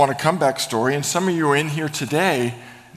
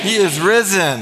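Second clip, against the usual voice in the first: louder, higher call of he is risen; call and response; 5 semitones higher, 5 dB louder than usual. Shown as a demonstration. Pastor calling out to the congregation, loud and high. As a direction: call it out to the room, bigger. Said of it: "Let's try it".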